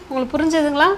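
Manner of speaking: ordinary delivery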